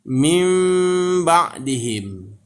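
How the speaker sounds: min ba'dihim drawn out, n turned to a held m hum; slow Quran recitation. Shown as a demonstration. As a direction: stretch ba'dihim slowly with a nasal hum. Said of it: "Jadi cara bacanya".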